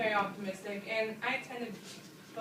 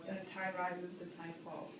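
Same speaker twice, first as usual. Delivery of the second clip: softer, lower closing lines of the poem; fading final refrain; 4 semitones lower, 7 dB quieter than usual. Shown as a demonstration. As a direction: let the refrain fade away as it ends. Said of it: "My favorite part of the poem is definitely the first stanza".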